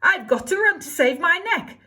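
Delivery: high, loud panicked shout. Acted. Cindy shouting as midnight strikes, high and loud. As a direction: yelp it as you bolt. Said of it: "She shouted".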